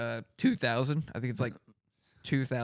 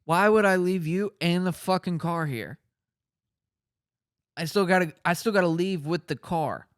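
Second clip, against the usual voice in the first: mock angry mom; 6 semitones higher, 4 dB louder than usual. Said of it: "She's like".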